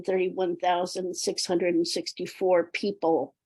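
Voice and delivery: normal talking voice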